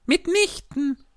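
high squeaky mouse, firm and indignant; a cross little mouse cutting her off; decidedly and very crossly. A narrator's reading. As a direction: mouse flatly refusing Alice. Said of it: "entschieden und sehr ärgerlich".

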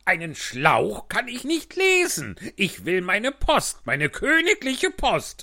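high, loud, slow royal tantrum; angry king. Acted. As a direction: throw a spoiled king's tantrum. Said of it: "Der König war sehr ärgerlich".

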